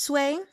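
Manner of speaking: Cui held high and level; Mandarin first tone. Shown as a demonstration. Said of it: "That's gonna be the first tone".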